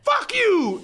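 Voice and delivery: high, wild yell in bursts; crazed shouting. Retold or imitated. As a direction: imitate a crazed man yelling abuse. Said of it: "wildly swinging the crutch at the glass".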